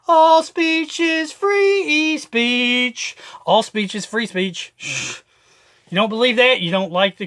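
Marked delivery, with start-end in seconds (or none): 0.1-3.2 slow raised slogan. Shown as a demonstration. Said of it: "same slogan, higher take".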